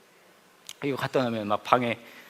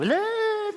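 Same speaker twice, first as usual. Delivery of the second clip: high, silly growling grr; mock growl; much slower than usual. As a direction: goof around with a playful grr. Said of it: "막". (장난스럽게 높은 소리로 으르렁)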